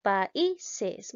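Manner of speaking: "Países" syllable by syllable, weight on I; stress on the I. Shown as a demonstration. Teacher confirming the answer, stressing I in "Países". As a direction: separate and stress the middle I of "Países". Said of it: "la vocal I".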